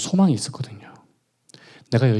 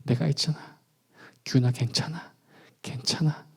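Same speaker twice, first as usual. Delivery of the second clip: much softer, tender reassurance; God comforting; 9 dB quieter than usual. Retold or imitated. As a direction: hush him like a father soothing a child. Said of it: "위로해 주시더라고요".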